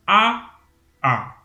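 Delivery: first "A" pitched high, second "A" dropped low, both loud; high then low A. Shown as a demonstration. Teacher putting high and low tones on the vowel A, loud and clear. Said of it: "Si je mettais un A".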